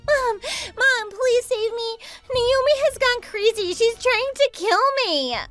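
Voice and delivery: high, whiny cry for rescue; high-pitched victim act; panicked pleading. Acted. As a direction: beg your mother to save you, pitched high. Begging mother for rescue.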